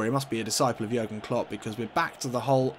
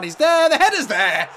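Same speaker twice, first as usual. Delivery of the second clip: much higher and louder goal cry; excited goal call; 12 semitones higher, 9 dB louder than usual. Real, not acted. Gamer celebrating his striker's header, excited goal call.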